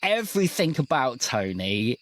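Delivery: normal talking voice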